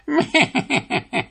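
high, falling sly chuckle; a devilish little snicker; sly laugh. Acted. Devilish apprentice laughing to himself, high sly chuckle.

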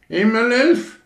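sehr hoch, laut erschrocken aufgeschrien; Gans schreit. Acted. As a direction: schrei auf wie eine erschreckte Gans. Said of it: "schrie die Gans". (Gans schreit erschrocken auf)

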